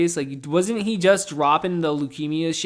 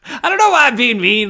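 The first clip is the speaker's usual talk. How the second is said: laughing; 8 semitones higher, 10 dB louder than usual. Real, not acted. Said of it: "I'm trying to be funny".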